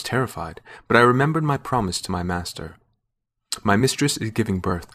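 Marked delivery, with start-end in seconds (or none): none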